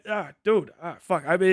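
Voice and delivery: ordinary talk, nothing marked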